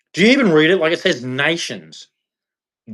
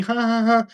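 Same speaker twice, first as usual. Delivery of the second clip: high sneering ha ha ha; mock laugh; 8 semitones higher than usual. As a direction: mimic their laughing. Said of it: "I see all yous laughing".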